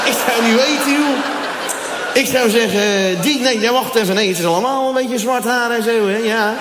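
a local fumbling to pick the Moroccan out; mock clueless Dutchman. Dutchman voiced in a bit.

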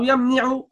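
يمنع pronounced "yamni'u"; kasra on the middle letter. Shown as a demonstration. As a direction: give يمنع an i vowel, yam-ni-'u. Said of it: "لا". (نطق يمنع بكسر العين: يَمْنِعُ)

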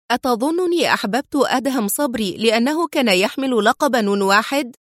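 ordinary delivery

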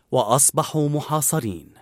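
ordinary delivery, nothing marked

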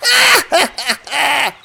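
high, loud pirate laugh; acted roaring laugh; loud guffaw. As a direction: burst into loud mocking laughter. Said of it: "Морган громко расхохотался".

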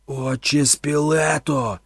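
loud, very slow bellowed name. A narrator's reading. Thorn bellows at the priest's lifeless face. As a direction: roar his name with all your strength. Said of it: "чимдуж заволав Торн".

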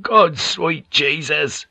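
strained cry of agony; blinding pain. A narrator's reading. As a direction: groan out the oath slowly in pain. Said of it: "There was a blinding pain".

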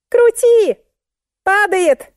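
very high, loud squeal; panicked scream. A narrator's reading. Musenka warns narrator the load is falling, screaming. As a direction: shriek the warning urgently.

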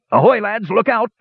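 high-pitched cry of alarm; urgent warning. A narrator's reading. Dwarf captain warning the brothers to duck, urgent. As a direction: break off to shout a warning. Sudden warning as sail returns.

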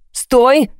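sudden commanding shout; barked order. A narrator's reading. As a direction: bark it to halt someone. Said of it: "рявкнул он".

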